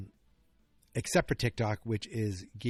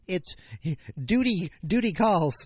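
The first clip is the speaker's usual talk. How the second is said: mock dutiful; 5 semitones higher, 4 dB louder than usual. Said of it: "So I'm like".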